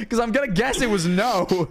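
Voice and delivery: ordinary delivery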